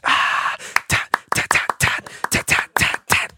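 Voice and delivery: loud trumpet fanfare ta-ta; vocal fanfare. Acted. Fanfare after the intro.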